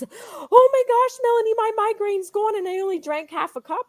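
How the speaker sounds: high, breathy-free squeal of delight, slowing on oh my gosh; excited friend. Retold or imitated. Guest retelling her friend's joy to the host, excited high gushing. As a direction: gush like a friend whose headache just vanished.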